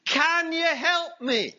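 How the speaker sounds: high, slow plea for help; pleading. Acted. Man dangling on a bush pleads upward, high slow plea. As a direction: beg for rescue, slowly. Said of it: "he pleads".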